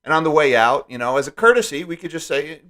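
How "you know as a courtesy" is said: usual speaking voice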